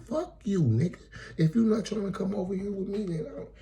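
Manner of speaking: high-pitched mock female voice; imitating angry woman. Woman giving him an ultimatum, mock-angry high voice.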